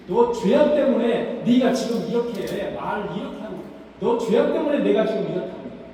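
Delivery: loud accusing jab at Job; Eliphaz accusing. Retold or imitated. Eliphaz loudly blames Job for his own suffering.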